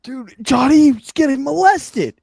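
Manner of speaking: loud, high, drawn-out outcry; mock outrage. Real, not acted. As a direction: wail it in exaggerated dismay. Mock outrage at where joke goes.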